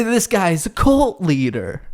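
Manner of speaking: normal talking voice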